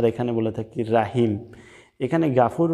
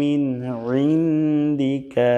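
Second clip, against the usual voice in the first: slow, drawn-out Arabic chant; slow Quranic recitation; 3 semitones higher, much slower than usual. Acted. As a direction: recite the dua with long held vowels. Full slow recitation of the dua.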